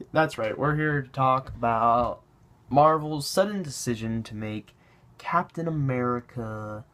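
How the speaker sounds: normal talking voice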